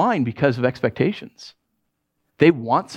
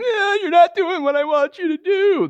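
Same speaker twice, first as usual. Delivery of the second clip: high, whiny mock child; whining child; 13 semitones higher than usual. Preacher mimicking whining children, high and nasal-free whine. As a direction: whine it like a spoiled kid. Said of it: "like little whining children".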